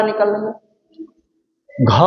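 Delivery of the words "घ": "घ" ज़्यादा साँस और अंत में ह की ध्वनि के साथ; "घ" में साफ़ ह सुनाई दे; महाप्राण का उच्चारण. Shown as a demonstration. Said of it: "अंत में ह कार की ध्वनि निकल रही है". ("घ" का स्पष्ट महाप्राण उच्चारण)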